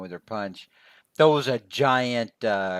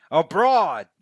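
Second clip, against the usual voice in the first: louder, higher mock exclamation; retold yell; 5 semitones higher, 5 dB louder than usual. Co-host quoting a comic character's outburst, mock yell. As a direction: call it out as a comic-book outburst. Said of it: "he actually yells out".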